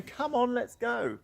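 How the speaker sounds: high, bright and sure; a guide confidently saying come on; confident encouragement. Shown as a demonstration. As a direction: urge the horse on with confidence. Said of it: "should be confidently saying".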